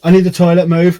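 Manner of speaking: loud, high, urgent demand. Retold or imitated. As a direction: boss them out of the toilet. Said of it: "I literally go".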